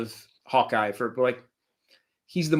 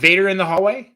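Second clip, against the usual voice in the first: excited; 7 semitones higher, 6 dB louder than usual. Real, not acted. Excited about favourite scene.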